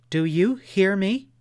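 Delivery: loud, high, insistent challenge; defiant demand. A narrator's reading. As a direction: make him listen. Stamps foot, defying her guide.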